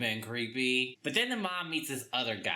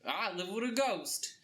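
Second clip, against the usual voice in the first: mock smug kids; 6 semitones higher than usual. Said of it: "They're just like".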